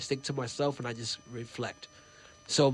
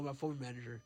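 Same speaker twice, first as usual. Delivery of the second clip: ordinary talk, nothing marked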